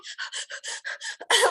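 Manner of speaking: voiceless, gasping puffs; panicked panting. Retold or imitated. Climber who just made it across, panicked panting. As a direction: act out catching breath in panic.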